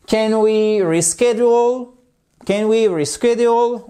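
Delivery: "Can we reschedule?" pitched high and lifted at the end; high-pitched closed question, "reschedule" going up; rising question intonation. Shown as a demonstration. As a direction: lift the tune on "reschedule" for a yes-no question. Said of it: "On remonte l'intonation".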